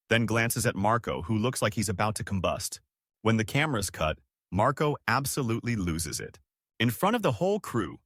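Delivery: ordinary delivery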